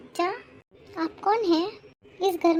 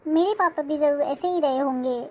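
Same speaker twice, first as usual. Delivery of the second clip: ordinary delivery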